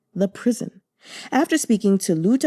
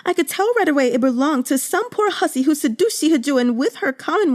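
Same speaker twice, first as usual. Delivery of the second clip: put-on young maid going from indignant to furious and much higher; maid building to outrage; 9 semitones higher than usual. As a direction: work yourself up from gossip into near-shrieking fury on your lady's behalf. Yuan Yang rants about the betrayal.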